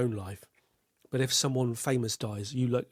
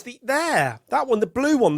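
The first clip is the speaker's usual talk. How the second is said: impatient pointing; 10 semitones higher, 6 dB louder than usual. Retold or imitated. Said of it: "And he goes".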